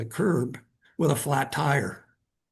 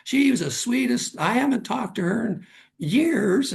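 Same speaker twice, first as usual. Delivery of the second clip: high, delighted grandmother voice; excited grandma; 8 semitones higher than usual. Retold or imitated. Grandma hears her old friend's name, high and delighted. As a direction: gush it out like a thrilled grandma. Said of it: "And she goes".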